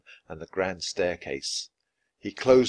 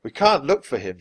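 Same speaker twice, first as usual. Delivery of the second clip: raised, higher voice of protest; shouting; 5 semitones higher, 5 dB louder than usual. A narrator's reading. Jack stops Annie searching for the dog, shouting. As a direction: call out an urgent objection. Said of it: "shouted Jack".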